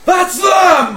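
high, very loud outburst; furious shout. Acted. Furious man storming downstairs at the outsiders, shouting. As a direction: bellow it in fury as an accusation. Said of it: "And he just shouts".

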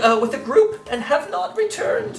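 high, fretful town priest; worried priest pleading for help; high cleric voice. Acted.

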